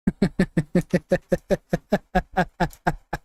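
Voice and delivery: long real fit of laughter. Real, not acted. Forgetting fighters' names, breaking into a long real laugh. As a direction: laugh helplessly for a while. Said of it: "having way too much fun right now".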